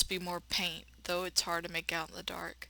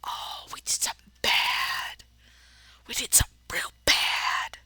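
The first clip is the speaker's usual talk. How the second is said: unvoiced, ominous whisper; spooky whisper; 4 semitones higher, 5 dB louder than usual. Player hints at the husband's crime, ominous whisper. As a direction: hiss the guilt like a secret.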